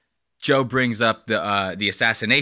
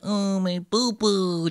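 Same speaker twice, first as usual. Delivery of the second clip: put-on higher, slow baby talk; childish whine; 7 semitones higher, 4 dB quieter, slower than usual. Vlogger mimics Trump as a whiny child, slow higher baby talk. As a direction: drag it out like a hurt toddler. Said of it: "He's like a child".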